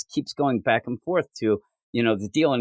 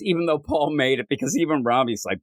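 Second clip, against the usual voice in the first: usual speaking voice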